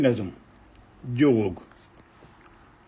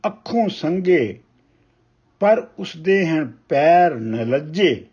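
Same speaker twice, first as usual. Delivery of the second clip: measured, weighty reading of a Punjabi poem; ਕਵਿਤਾ ਪਾਠ ਦੀ ਠਹਿਰਾਵ ਵਾਲੀ ਅਵਾਜ਼; poem recitation; 2 semitones higher, 3 dB louder than usual. Acted. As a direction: recite each line slowly, letting pauses breathe. Poet recites poem Lukan Miti.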